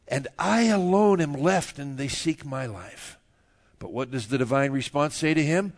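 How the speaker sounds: measured, formal reading voice; scripture reading. Acted. Pastor reading Romans to the church, formally. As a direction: read the verses aloud to the congregation.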